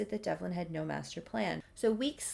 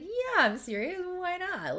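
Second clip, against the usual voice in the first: excited Leif; 11 semitones higher than usual. Retold or imitated. Leif eagerly accepting date.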